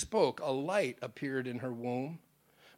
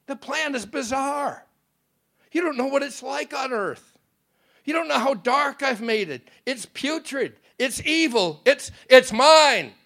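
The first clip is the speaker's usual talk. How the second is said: high, loud, rising outburst; thundering; 10 semitones higher, 10 dB louder than usual. A narrator's reading. Satan protesting to the Father about earth, thundering. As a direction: thunder out the claim of ownership. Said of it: "thundered the voice".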